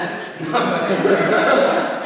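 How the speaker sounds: bursting into laughter; loud laugh. Real, not acted. Laughing at French joke.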